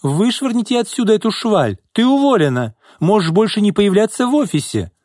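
high-pitched angry yell. A narrator's reading. Boss calls security on her secretary, yelling. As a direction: yell for help in outrage. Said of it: "завопила Изольда".

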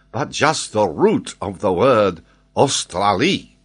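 put-on high, emphatic French-accented voice; excitable French geographer. Geographer explains his sudden flash to the Major, excited. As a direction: explain it loudly and eagerly, swooping in pitch.

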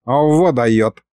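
plain reading voice

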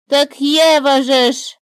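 the bird delighted to have found a word; glad exclaim. A narrator's reading. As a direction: shout it out in joy. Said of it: "обрадованно воскликнула птица".

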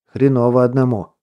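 ordinary delivery, nothing marked

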